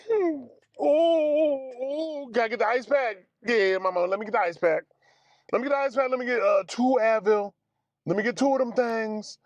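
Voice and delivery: high, urgent groaning in pain; patient in pain after the blockers wear off, high and urgent; mock pained scramble. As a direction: hurry for the ice pack, hurting.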